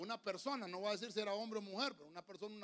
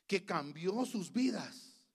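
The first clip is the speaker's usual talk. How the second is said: ordinary delivery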